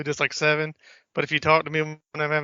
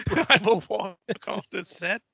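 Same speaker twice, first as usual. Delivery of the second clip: laughing mock threat; 4 semitones higher than usual.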